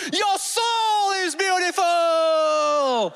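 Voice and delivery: high, long drawn-out yell; shouted affirmation. Retold or imitated. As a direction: shout praise in someone's face, stretching the words. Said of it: "my teacher will shout it at your face".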